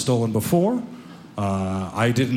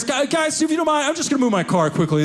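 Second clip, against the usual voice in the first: high and fast, sheepish excuse; nervous self; 10 semitones higher than usual. Retold or imitated. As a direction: hurry the excuse out to friends. Nervous asking friends.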